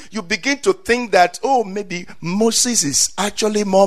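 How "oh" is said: ordinary talk, nothing marked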